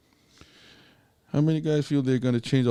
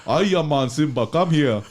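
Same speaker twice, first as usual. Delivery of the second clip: put-on African accent; 4 semitones higher, 6 dB louder than usual. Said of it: "Shouldn't Mufasa say".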